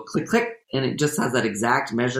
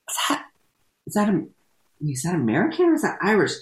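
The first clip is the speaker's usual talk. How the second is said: higher, quick, baffled question; puzzled; 6 semitones higher, faster than usual. Retold or imitated. Host retells watching a TV actor, higher baffled questioning. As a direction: sound thrown by the accent. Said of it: "And I was like".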